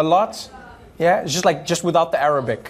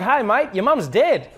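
slow, cheery Australian delivery with a laugh; Australian accent, bright and high, giving bad news; 6 semitones higher, slower than usual. Shown as a demonstration. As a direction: deliver bad news in a happy Aussie lilt. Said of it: "Australian ... gave you bad news".

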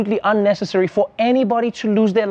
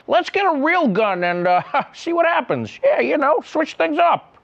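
higher-pitched, chuckling mock reckless enthusiasm; giddy Hollywood producers; 7 semitones higher than usual. Host imitating Hollywood choosing real guns, giddy and reckless. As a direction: imitate a thrill-seeker giggling at a risky idea.

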